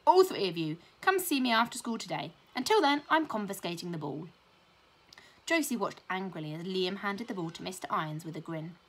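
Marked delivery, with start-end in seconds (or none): 0.1-4.3 stern order. A narrator's reading. Teacher announcing detention.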